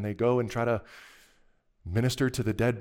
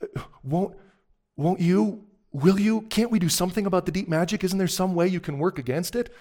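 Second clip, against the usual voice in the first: higher, halting voice of Susan; pleading Susan; 7 semitones higher, 3 dB louder than usual. A narrator's reading. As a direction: plead haltingly, then let it tumble out. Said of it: "Susan whispers".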